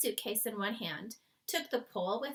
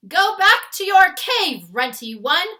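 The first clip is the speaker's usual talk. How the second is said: loud high jeer; bullying giants shouting; 10 semitones higher, 19 dB louder than usual. A narrator's reading. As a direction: shout him back home, mocking. Giants jeering.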